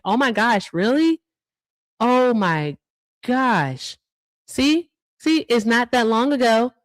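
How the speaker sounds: oh my gosh, real surprise; shocked. Real, not acted. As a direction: react in real shock to the news. Shocked by donor's story.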